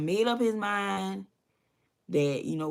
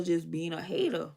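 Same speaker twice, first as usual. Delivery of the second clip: usual speaking voice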